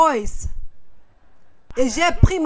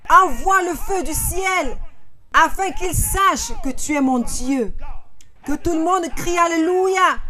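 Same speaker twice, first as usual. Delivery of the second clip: preacher crying out to God; fervent shout; 4 semitones higher than usual. Real, not acted. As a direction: call it out over the congregation. Prayer for fire, call out.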